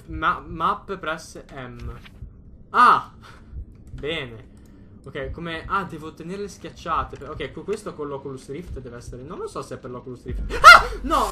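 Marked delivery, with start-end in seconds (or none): 2.7-3.1 startled scream. Real, not acted. Jump scare in horror game. 10.5-11.3 sudden loud scream of fright; startled scream. Real, not acted. Jump scare in horror game.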